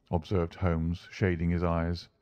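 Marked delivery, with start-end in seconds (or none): none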